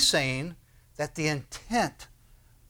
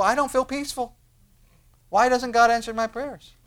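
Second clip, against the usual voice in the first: high-pitched, put-on whine of frustration; mock complaint; 8 semitones higher, 4 dB louder than usual. Believer rising from prayer still without peace, mock complaint. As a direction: complain upward like a let-down believer. Acting out disappointed person after praying.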